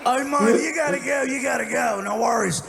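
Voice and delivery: high, loud mock Australian accent; hammed-up boozy Australian farewell; drunk Australian. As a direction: reassure him in a broad Australian voice. Voices the drunk Australian pirate replying.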